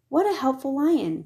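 the townsfolk marvel at the lion; cheerful praise. A narrator's reading.

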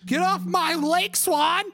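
high, drawn-out comic yell; mock yelling. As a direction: holler at a swan, hammed up. Yelling a Billy Madison movie line.

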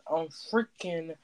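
ordinary talk, nothing marked

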